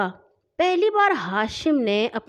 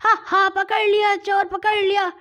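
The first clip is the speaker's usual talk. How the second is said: high, gleeful gotcha yell; raised, high-pitched triumphant cry; loud shout; 10 semitones higher than usual. A narrator's reading. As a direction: crow triumphantly at catching him. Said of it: "زور سے چلایا".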